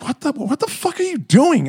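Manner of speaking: high-pitched imitation of a baffled buddy; shocked buddy. Acted buddy walking in shocked.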